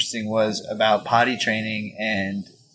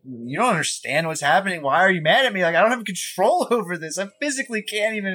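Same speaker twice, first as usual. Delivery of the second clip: higher-pitched mock child's protest; imitated upset child; 7 semitones higher than usual. Child protesting to an angry parent, acted pleading in a higher voice. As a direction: plead like a misunderstood kid.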